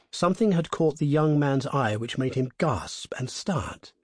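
usual speaking voice

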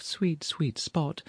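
slow, soft, high lament; sighing to herself. Acted. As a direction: murmur it mournfully, drawn out. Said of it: "paused on the hall mat, said to herself".

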